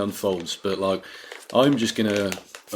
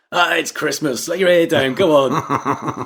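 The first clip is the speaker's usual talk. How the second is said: higher, louder mock cop waving it off; mock lenient cop; 6 semitones higher, 5 dB louder, slower than usual. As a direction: play a cop who shrugs off a bomb, laughing at the end. Cop voiced in imagined scene.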